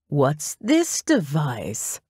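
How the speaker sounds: slow, drawn-out question with big pitch swoops; theatrical Derek. A narrator's reading. Derek asks Mitzi about the pile of metal, theatrical and drawn out. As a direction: stretch each word theatrically.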